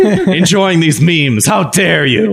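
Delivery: hammed-up indignant scolding; Greta Thunberg. Friend mocking a scold over overused Keanu, mock-indignant. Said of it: "turn into a little Greta Thunberg".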